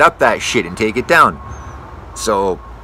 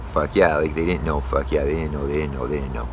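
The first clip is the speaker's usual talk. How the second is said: much softer, grumbling under his breath; muttered aside; 9 semitones lower, 6 dB quieter than usual. Real, not acted. Arborist grumbling to himself about clueless customers, muttered. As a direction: mutter it to yourself, resigned.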